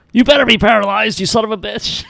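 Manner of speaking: mock Rick Steiner. Rick Steiner yelling at Buff, a mock threat. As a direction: yell it like a furious threat. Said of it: "that's basically what Rick Steiner is saying".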